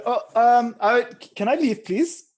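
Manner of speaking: put-on high, loud, pleading German accent. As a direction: beg to go in a caricature German accent. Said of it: "The German sound individual".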